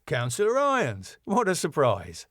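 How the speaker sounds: brightly feigned welcome; bright greeting. Acted. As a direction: greet them brightly, as if delighted. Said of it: "Tom, brightly".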